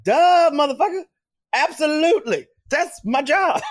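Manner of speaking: high-pitched, exaggerated comic comeback; brazen retort. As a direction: shoot it back like it's blindingly obvious. Retorting to colleagues who suspect him.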